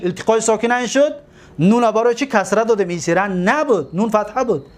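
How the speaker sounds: ordinary talk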